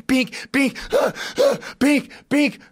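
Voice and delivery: high-pitched "bink" knocks with frantic gasps; imitated banging and gasps. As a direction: act out banging against rock, gasping between hits. Imitates tanks banging while stuck, gasping.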